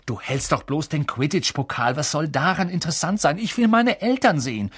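put-on high, indignant boy arguing; squabbling. A narrator's reading. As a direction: argue with rising pitch and impatience. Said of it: "setzte ihrer Streiterei ein Ende".

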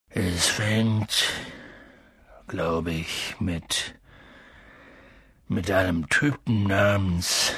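low, soft, halting voice; hesitant. A narrator's reading. Giant avoiding a feared name, low and halting. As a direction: stall before the name. Hagrid struggles to begin the story.

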